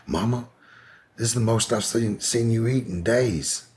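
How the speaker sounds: a hint of the son's amazed voice; son's quote. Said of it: "her son was like".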